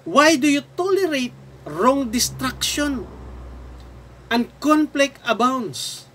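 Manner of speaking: slow, emphatic Bible reading; drawn-out questions to God, falling away at the end; scripture lament. Acted. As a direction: declaim the lament phrase by phrase. Reads Habakkuk's lament aloud.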